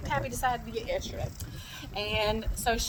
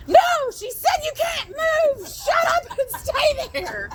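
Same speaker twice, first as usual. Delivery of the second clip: very high, drawn-out mock scream; hammed-up high shriek, slowed down; retold exasperated yell; slower than usual. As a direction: re-enact screeching orders at an injured parent. Retold yelling at dad during call.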